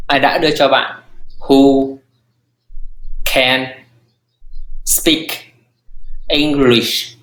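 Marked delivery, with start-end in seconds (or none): 1.4-7.2 pauses between every word. Shown as a demonstration. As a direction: drill it slowly word by word. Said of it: "Là sự who".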